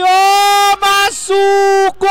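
long, high stretched goal scream; goal call. Real, not acted. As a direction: roar the goal, drawing out gol. Goal scored, commentator erupts.

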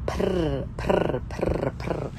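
rolled "prr" sound; trilled prr. Shown as a demonstration. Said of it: "he don't know how to say prr".

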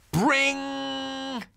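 high, ringing doorbell noise. Acted. Narrator mimicking the doorbell, high and ringing. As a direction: ring it out like a doorbell.

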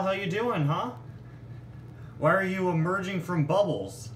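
normal talking voice

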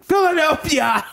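Philadelphia pitched high, theatrical; mad scientist. Shown as a demonstration. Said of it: "I sound like a mad scientist".

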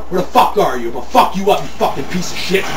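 ordinary delivery

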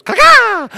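very high, loud crow call; crow caw. Retold or imitated. Wife mocking his messy hair with a loud high crow caw. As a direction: squawk like a crow. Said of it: "she goes".

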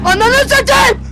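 loud high drawn-out yell; startled shout. Real, not acted. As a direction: scream the curse in fright. Scared curse shout.

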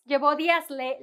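usual speaking voice

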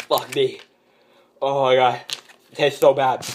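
normal talking voice

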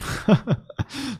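short high-pitched giggle. Real, not acted. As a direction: let a bright giggle slip out. Giggle after teasing secret.

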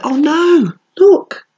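high squeal of dismay. Real, not acted. Reading to kids, jelly falls: high squeal of dismay.

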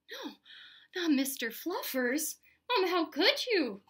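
slow, drawn-out wounded protest; dramatic dismay. A narrator's reading. Spencer pleads for Mister Fluffers, aghast and dramatic. Spencer horrified over his bunny.

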